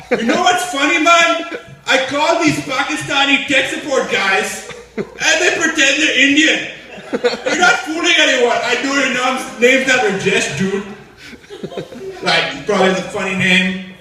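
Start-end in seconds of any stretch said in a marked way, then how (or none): 0.1-10.8 higher, excited Indian caller voice; mocking Indian guy. Indian man laughing about Pakistani tech support, higher and gleeful. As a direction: gloat like a smug Indian guy.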